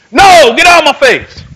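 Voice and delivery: loud, high, explosive refusal; acted angry shout. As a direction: imitate a man yelling someone away.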